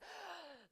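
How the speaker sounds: sharp mock gasp; dramatic gasp. Quiz host revealing a wrong answer, mock gasp. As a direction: gasp before a stage reveal.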